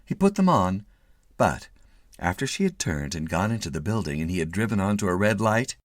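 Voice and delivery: ordinary delivery, nothing marked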